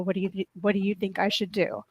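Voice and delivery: usual speaking voice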